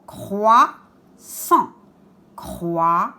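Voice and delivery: "croissant" split as croi, pause, ssant; syllable by syllable. Shown as a demonstration. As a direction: sound out the two syllables of "croissant" separately. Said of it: "Où est le R?". (« croissant » découpé en deux syllabes espacées)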